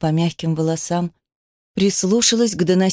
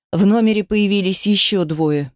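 ordinary delivery

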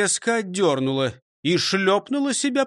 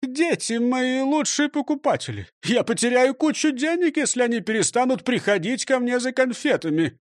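lightly voiced proud shop owner; pompous director; 8 semitones higher than usual. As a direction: puff yourself up. Director argues. (слегка важный директор)

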